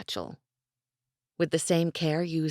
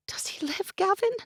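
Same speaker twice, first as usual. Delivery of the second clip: put-on high, hushed young woman, afraid for her brother; young woman's voice. A narrator's reading. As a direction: ask it in a frightened, breathless hush. Isabel's voice, anxious question.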